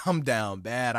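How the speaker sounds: slow, laughing admission; chuckling I'm down bad. Real, not acted.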